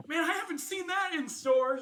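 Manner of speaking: high, loud, starstruck gush; gushing party guest. Acted. Party guest gushing over the player's armor, high and loud.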